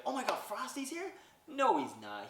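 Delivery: high, loud mock squeal of excitement; excited squeal. Noelle hearing Frosty is near, squealing high and loud. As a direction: play up the starstruck gasp. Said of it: "this is where she's like".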